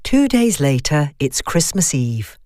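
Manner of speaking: ordinary delivery, nothing marked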